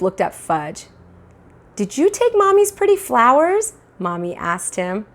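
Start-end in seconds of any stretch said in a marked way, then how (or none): 1.7-3.7 put-on high sing-song voice for a toddler; coaxing mother. Mother asking toddler about flowers, high coaxing voice. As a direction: coax the little one sweetly.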